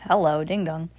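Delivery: ordinary delivery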